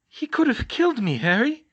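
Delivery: high, loud and trembling after a near miss; Georgina, rescued, cries out to Harry in fear; shaken and scared. Acted. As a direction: cry it out, still shaking from the curse. Shaken after nearly being cursed.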